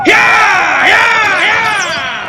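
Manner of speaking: very high, loud whoops; whooping yells. Acted. Dan riding off to draw the raiders away, wild whooping yells. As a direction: whoop as you gallop off. Charging off as a decoy.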